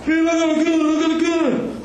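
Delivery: high, hammed-up alarm; mock panicked friend. Retelling the arrested friend's cry about the gun, high and mock-alarmed. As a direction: imitate the friend pointing at the gun. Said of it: "And he like".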